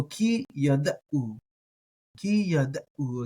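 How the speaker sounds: ki yada'u broken into slow syllables. Shown as a demonstration. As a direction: spell out ki yada'u slowly. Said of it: "I like the uh there for the ayin".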